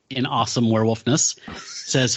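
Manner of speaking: ordinary delivery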